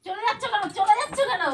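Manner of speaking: high, loud call after someone. Real, not acted. Prankster calls after departing husband, high and loud. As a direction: call urgently to stop them leaving.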